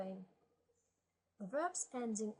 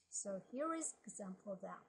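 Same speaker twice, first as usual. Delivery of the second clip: ordinary talk, nothing marked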